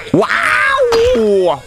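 delighted shout; amazed wow. Real, not acted. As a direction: gasp at the hit. Big hit.

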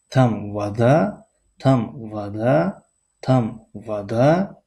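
slow question tune on تم ودا; question intonation. Shown as a demonstration. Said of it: "جمله سوالی شد". (آهنگ سوالی آهسته روی تم ودا)

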